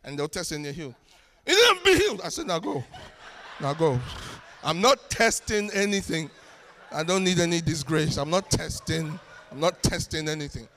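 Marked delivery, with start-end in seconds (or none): 1.5-2.2 loud, high re-enacted outburst; shouted prayer. Re-enacts loud prayer over broken leg. 2.5-10.7 loud, lower, dismissive send-off; acted firm refusal. Re-enacts dismissing man, refusing to test.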